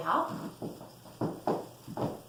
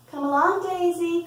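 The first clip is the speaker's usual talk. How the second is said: Mama Duck's higher, louder call; raised, singsong summons; 7 semitones higher, 6 dB louder than usual. A narrator's reading. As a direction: call to a little one to follow. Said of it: "called Mama Duck".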